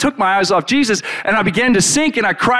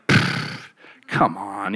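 low, soft, unbothered scoff; dismissive Jesus; 8 semitones lower, 7 dB quieter, much slower than usual. Retold or imitated. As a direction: wave their panic away. Jesus brushes off their fear.

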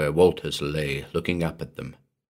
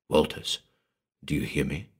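soft, low call; low, husky voice; 5 semitones lower, 7 dB quieter than usual. A narrator's reading. Man calling down a tube to the sleeper, low and husky. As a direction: call softly down, keeping it hushed. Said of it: "in a low, husky voice".

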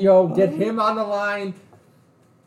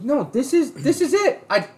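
indignant retort; 10 semitones higher, 3 dB louder than usual. Retold or imitated. Retold confrontation with clerk.

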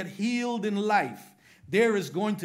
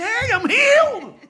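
far higher, much louder, slow and laughing; joyful church shout; 15 dB louder than usual. Retold or imitated.

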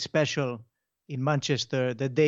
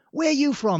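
much higher and louder, chummy mock tourist; cheery tourist question; 11 semitones higher, 5 dB louder than usual. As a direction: chirp it like a Brit abroad spotting a local. Tourists greeting at bar.

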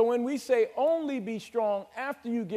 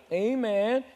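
ordinary talk

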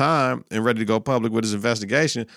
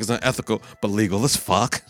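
imitating Matt. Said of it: "That's what Matt be like".

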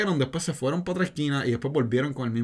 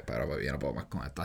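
low, soft weary sigh; 11 semitones lower, 9 dB quieter than usual. Retold or imitated. Worn-out clerk, retold.

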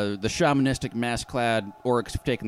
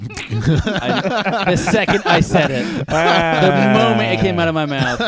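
laughing; 7 semitones higher, 8 dB louder, slower than usual. Real, not acted. GM laughing at own slip.

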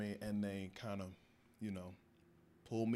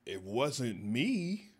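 slow, louder flat denial; firm denial; 2 semitones higher, 6 dB louder, much slower than usual. Retold or imitated. Suspect denying the robbery to guards, slow and firm. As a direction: lay each word down slowly.

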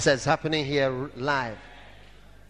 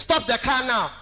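urgent command; 8 semitones higher, 4 dB louder than usual. Retold or imitated. Divine voice warns louder.

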